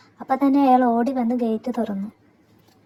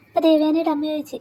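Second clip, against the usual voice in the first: ordinary delivery, nothing marked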